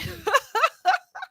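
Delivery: high squealing laugh. Real, not acted. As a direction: burst out laughing. Laughing after mock outrage.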